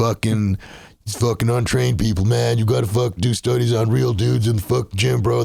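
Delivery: cocky gym bro swagger. Acted. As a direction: play a crude, pumped-up gym bro.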